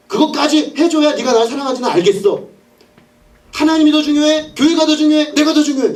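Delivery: high, insistent acted challenge; demanding ultimatum. Woman making lover choose her or church, high insistent demand.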